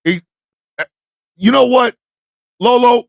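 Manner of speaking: normal talking voice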